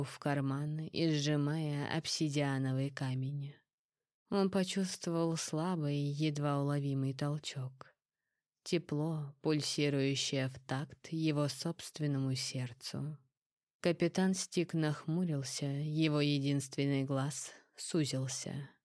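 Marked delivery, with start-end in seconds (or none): none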